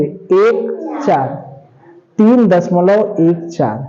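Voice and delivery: एक चार read slowly as separate digits, not चौदह; slow teacher's digit-by-digit reading. Shown as a demonstration. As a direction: spell out एक चार one digit at a time. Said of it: "इसको चौदह नहीं कहेंगे".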